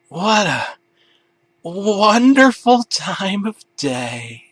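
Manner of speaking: high, slow, dazed; dreamy stoned voice. Acted. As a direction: drift through it blissfully high. Stoned character voice.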